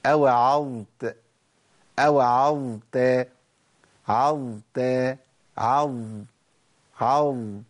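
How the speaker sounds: أوعظت broken down slowly to a long ظ; very slow breakdown demo. Shown as a demonstration. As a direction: isolate and hold the ظ in عظ. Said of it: "سامعين صوت الظاء".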